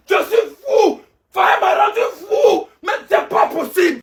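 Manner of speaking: high, exasperated shouting; driven mad. Acted. Fan driven crazy by coach Faye's list, high exasperated shout. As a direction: cry out like you're losing your mind.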